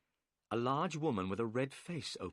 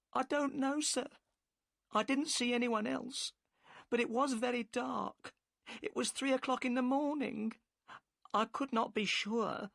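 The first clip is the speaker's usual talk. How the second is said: higher woman's voice, upset and defensive; the boatman's wife, worried and curt; 13 semitones higher than usual. A narrator's reading. Narrator voices Mrs Smith.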